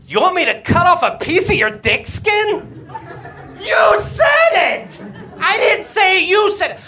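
mock outraged doctor shouting back. Doctor yells back in story.

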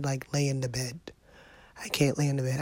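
normal talking voice

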